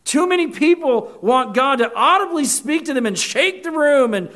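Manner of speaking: rising preacher's fervor; full-force preaching. Real, not acted. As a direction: press the point home to the congregation.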